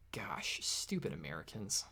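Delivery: whispered insult under the breath; whispered, not meant to be heard; muttered aside. Acted. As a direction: hide the jab in a whisper. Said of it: "What was that?".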